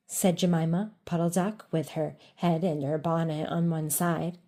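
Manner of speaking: ordinary delivery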